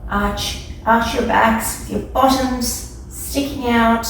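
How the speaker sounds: ordinary talk, nothing marked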